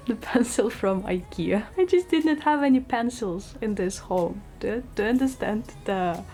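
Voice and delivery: ordinary talk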